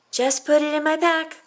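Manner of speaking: high, loud call. A narrator's reading. Violet calling to her father from her room, loud and high. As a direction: call it out to dodge the question. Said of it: "called Violet".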